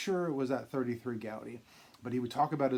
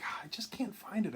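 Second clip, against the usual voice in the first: hushed; 7 semitones higher, 4 dB quieter than usual. Retold or imitated. Jim sadly retelling lost cards.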